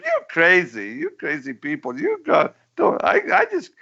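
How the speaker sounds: high, squeaky mock dictator, very matter-of-fact; cartoon Pol Pot voice. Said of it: "the way Pol Pot".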